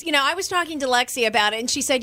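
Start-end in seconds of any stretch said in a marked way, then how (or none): none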